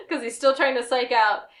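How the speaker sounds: ordinary delivery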